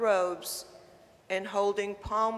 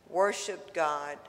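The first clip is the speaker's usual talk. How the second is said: normal talking voice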